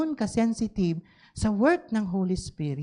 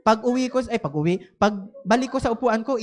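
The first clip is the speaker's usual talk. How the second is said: ordinary delivery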